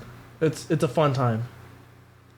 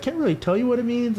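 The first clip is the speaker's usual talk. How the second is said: higher, light mock coworker voice; imitating coworker; 8 semitones higher than usual. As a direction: quote him higher, shrugging. Quoting coworker.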